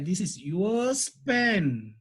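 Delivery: "yours" drawn out slowly for emphasis; stress on YOURS. Shown as a demonstration. Teacher showing what the extra pronoun adds, "yours" stressed. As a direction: lean long and hard on "yours". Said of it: "is to give a emphasis".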